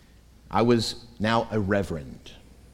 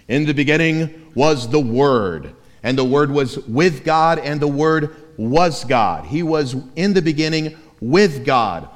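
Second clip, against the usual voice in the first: scripture recitation; 3 semitones higher, 5 dB louder than usual. Acted. Reciting John's prologue.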